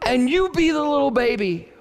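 put-on high small child's voice; little daughter's voice. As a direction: play the three-year-old, sweet and bossy. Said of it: "she said".

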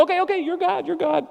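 high-pitched placating, breaking into laughter; mock-submissive, then a laugh; laughing deference. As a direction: imitate someone humoring God while laughing. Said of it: "and he's laughing. So he's doing both".

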